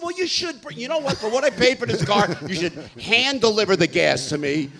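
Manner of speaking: loud, high indignant retort; indignant complaint. Acted. Car owner demands gas from Cadillac, loud indignant complaint. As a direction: push back hard at the caller.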